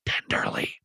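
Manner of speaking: soft whispered tenderly. Shown as a demonstration. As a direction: breathe the word tenderly. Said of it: "Don't tell me these guys didn't speak".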